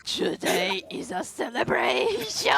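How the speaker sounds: mock moaning voice. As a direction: drag the words out like a moan. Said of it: "let me try doing the morning voice".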